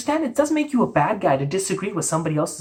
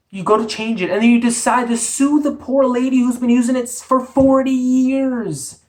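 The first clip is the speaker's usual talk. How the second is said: indignant rant; 6 semitones higher, 3 dB louder than usual. Real, not acted. Host outraged at band suing the poor lady, indignant. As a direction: rail at the injustice, voice pitched up.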